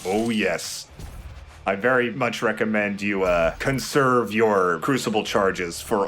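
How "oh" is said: ordinary talk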